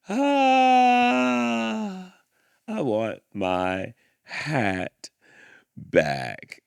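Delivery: wailing cry then slow pleading; hammed-up lament, dragging the words; crying out for the lost hat; mock anguished cry. Mock anguish over the title.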